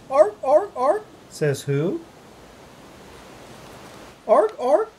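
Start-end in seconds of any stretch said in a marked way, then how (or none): 0.1-1.0 high, short seal barks. Pretend. Seal in picture book. 4.3-4.9 high, short seal barks. Pretend. Seal in picture book.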